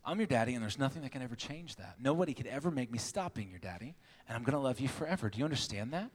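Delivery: gentle, heartfelt dad talk; tender reassurance. Retold or imitated. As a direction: reassure your little girl up close. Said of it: "I got down real close to her".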